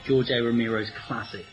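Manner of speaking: ordinary talk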